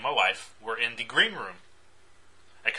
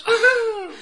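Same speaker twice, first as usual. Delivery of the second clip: very high, loud whoop; squealed mock celebration; mock cheer; 8 dB louder than usual. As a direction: let out a hammed-up cheer. Mock cheer about Spirit Awards.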